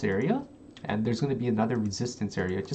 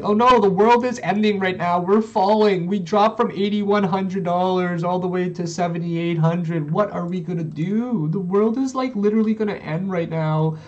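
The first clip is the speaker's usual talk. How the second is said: high, loud hammed-up doom-crying; mock panic; 6 semitones higher, 6 dB louder than usual. Streamer mimicking traders over a price drop, mock panic. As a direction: imitate everyone freaking out over a small drop. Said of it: "people were actually panicking right here".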